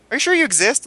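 high, incredulous clerk imitation; mock clerk voice. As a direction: pitch it up like a baffled clerk. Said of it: "They type for, like".

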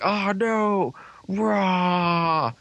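hammed-up cry of dismay; mock villain cry. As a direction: act out a villain being overpowered. Acting out a villain being overloaded.

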